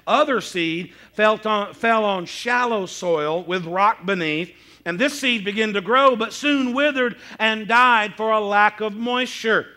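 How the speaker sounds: slow, emphatic Bible reading; scripture reading. Acted. Preacher reads the parable to the congregation, slow and emphatic. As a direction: read it out loud and deliberately, leaning on key words.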